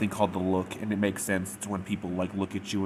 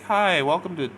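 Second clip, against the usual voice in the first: high, loud customer-service chirp; over-bright fake retail greeting; fake happy; 11 semitones higher, 5 dB louder than usual. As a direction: greet customers with put-on cheer, pitched way up. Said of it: "when you're fake happy".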